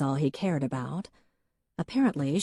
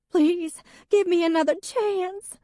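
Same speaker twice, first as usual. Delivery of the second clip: much higher, louder, sobbing plea; wife sobbing for one more chance; 6 dB louder than usual. A narrator's reading. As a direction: beg through sobs. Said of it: "The sobs were tearing out of her".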